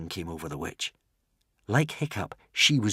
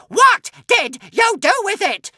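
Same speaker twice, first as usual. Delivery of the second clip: very high, slow loud scream; 9 dB louder than usual. A narrator's reading. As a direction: scream the question. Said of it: "screamed the witch".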